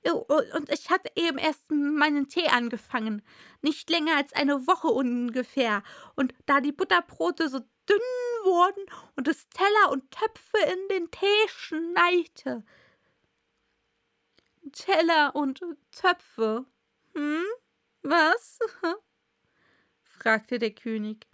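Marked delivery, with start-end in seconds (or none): 0.0-12.6 trembling Hatter, higher, getting whiny; zitternde Stimme. A narrator's reading. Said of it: "begann der Hutmacher mit zitternder Stimme". 14.7-19.0 slow, higher incredulous questioning; verdutzter König. Acted. King queries the Hatter's nonsense.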